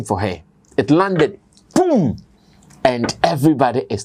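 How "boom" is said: high, drawn-out "boom"; booming sound effect. Retold or imitated. Host marking the big bank's arrival, booming sound effect. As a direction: punch out a high boom that falls away. Imitating the big bank landing.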